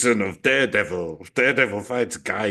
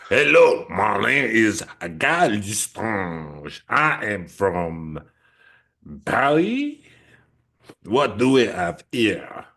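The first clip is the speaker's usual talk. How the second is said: bad French accent; 3 dB louder, slower than usual. Co-host introducing Guy Lestrange in a bad French accent. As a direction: put on a cheesy fake French accent. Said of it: "a really shit French accent".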